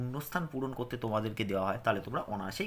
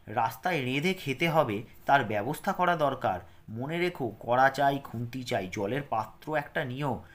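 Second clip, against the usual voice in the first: clear, careful lesson reading for pupils; reading aloud; 5 dB louder, slower than usual. Acted. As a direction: read it out slowly for children to follow. Reads the seventh lesson.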